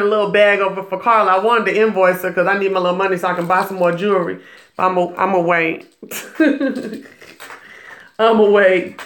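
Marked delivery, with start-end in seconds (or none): 6.0-7.0 short breathy giggle under the talk; real chuckle. Real, not acted. Amused about waiting on invoice.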